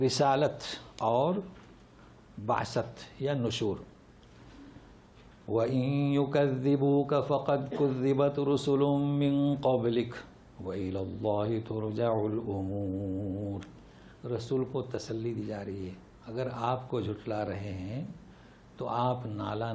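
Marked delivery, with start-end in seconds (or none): 5.5-13.7 chanted tilawat of the verse; Quranic recitation. Shown as a demonstration. Teacher recites the verse before explaining it, chanted.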